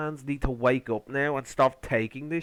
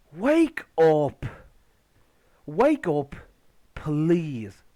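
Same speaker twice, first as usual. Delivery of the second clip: loud, emphatic wake-up call; exasperated plea; 4 dB louder, much slower than usual. Real, not acted. Host to fans defending the show, exasperated plea. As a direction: punch each word, pleading in exasperation.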